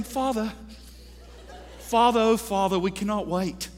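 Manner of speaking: a hint of a British choirboy; mock British accent. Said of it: "talking in a British accent".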